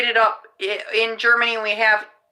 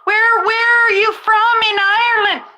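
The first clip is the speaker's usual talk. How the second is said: high, mock-excited question; hammed-up eager curiosity; acted eager questioner; 9 semitones higher, 3 dB louder than usual. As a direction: play an enthralled stranger, pitched way up. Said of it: "and then people are like".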